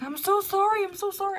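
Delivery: a photographer apologising in a fluster; flustered lady. Retold or imitated. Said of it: "the lady got flustered".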